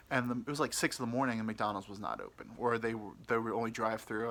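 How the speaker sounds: ordinary delivery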